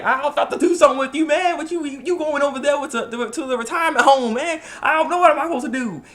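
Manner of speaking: high-pitched, whiny buddy voice; high, complaining dude impression; goofy high dude voice. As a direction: whine it up high like a let-down friend. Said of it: "and he's like".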